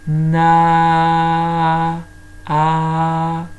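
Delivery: "Na, a" with long held aaaa; sing-hold the a, then repeat it alone; "Na, a" drawn out to isolate the vowel; stretched vowel. Shown as a demonstration. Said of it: "On entend de nouveau le A".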